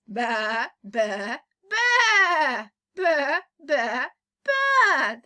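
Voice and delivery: loud playful baa imitation; sheep bleating. Shown as a demonstration. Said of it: "teacher imitates the sheep".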